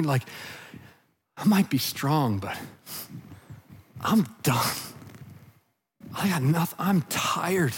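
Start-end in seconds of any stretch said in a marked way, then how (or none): 1.4-7.7 low, subdued, weary admission; worn out. Acted. Voicing someone strong who is worn out, weary and subdued. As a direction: confess exhaustion quietly, with heavy pauses. Voices an exhausted strong person.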